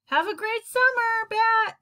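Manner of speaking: high call across the parking lot; friendly shouted farewell. A narrator's reading. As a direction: call a cheery goodbye from a distance. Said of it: "Mister Grayson called".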